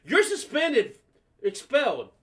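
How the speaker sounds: loud, high-pitched announcement of punishment; mom yelling. Retold or imitated. Mom relaying the principal's verdict to son, yelling. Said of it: "yelling at me".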